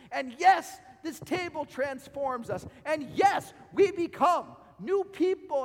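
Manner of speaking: loud, rising preacher's call; preaching at full force. Acted. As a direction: build the congregation up, full voice. Preacher building to climax.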